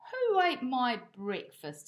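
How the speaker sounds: slow, woeful plea; a mild hungry-dog lament; miserable. Acted. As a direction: sound sorry for yourself, dragging it out. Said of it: "said Bob miserably".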